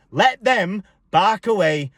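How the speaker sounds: ordinary talk, nothing marked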